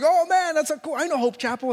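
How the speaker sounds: high-pitched, delighted reaction; excited recollection. Retold or imitated. Preacher retelling his delight at meeting a fellow churchgoer, excited. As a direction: blurt it out, thrilled, pitch jumping up. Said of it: "it was awesome. And I was like".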